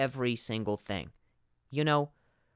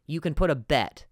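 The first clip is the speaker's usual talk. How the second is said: ordinary delivery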